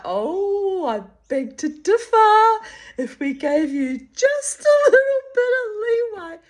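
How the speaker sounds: high, loud arch mock-disagreement; teasing friend. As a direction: arch and cheeky, sure she's right. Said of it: "she's like".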